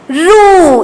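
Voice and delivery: रू with a long, held "oo"; syllable demo. Shown as a demonstration. Teacher showing how it is said, रू drawn out. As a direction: stretch the vowel of रू. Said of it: "इसको क्या बोलेंगे".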